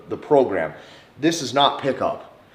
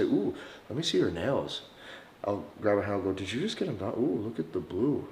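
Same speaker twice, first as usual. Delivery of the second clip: much softer, intimate coo; dumbed down cooing; 3 semitones lower, 10 dB quieter than usual. Shown as a demonstration. Admiring a woman's nails, soft cooing. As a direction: admire her nails softly. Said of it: "I'll say".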